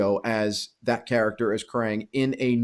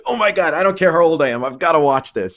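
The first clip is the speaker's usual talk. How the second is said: excited gush; 4 semitones higher, 5 dB louder than usual. Retold or imitated. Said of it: "I said".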